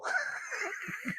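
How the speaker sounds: soft high stifled laugh. Real, not acted. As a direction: laugh under your breath.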